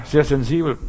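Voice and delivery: normal talking voice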